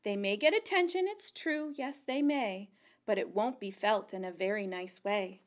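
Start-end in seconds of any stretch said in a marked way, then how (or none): none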